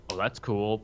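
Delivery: ordinary talk, nothing marked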